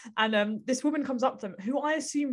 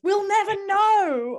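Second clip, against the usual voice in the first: high, slow, drawn-out mock despair; dramatic lament; 10 semitones higher, 8 dB louder, much slower than usual. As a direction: stretch it out like a tragic ending.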